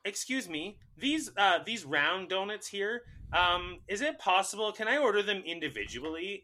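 high, mock-polite customer imitation; obnoxious customer. Dad asking server about round donuts, high obnoxious imitation. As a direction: put on a clueless customer's high voice.

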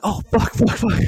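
high, strained burst of curses; yelped curses at the shock; pained cursing. Retold or imitated. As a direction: blurt it out in sudden pain. Reacting to sudden toe injury.

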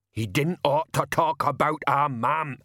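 slow, aggrieved big thug; voice like an earthquake. A narrator's reading. Banjo objects to talk about his mother, slow heavy voice. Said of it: "The voice was like an earthquake".

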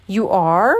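loud, baffled question; confused exclamation. A narrator's reading. Pooh told to say who he is, exclaiming confusedly. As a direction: blurt it out in confusion. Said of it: "exclaimed the now totally confused bear".

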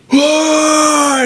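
high, loud startled yell; shocked shout. Retold or imitated. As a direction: yell it in total disbelief. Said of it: "he's just like".

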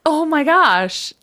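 high-pitched real astonishment; amazed. Real, not acted. Co-host reacts to the actor's daughter news, amazed. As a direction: marvel at it, pitch up.

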